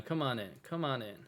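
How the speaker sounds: ordinary delivery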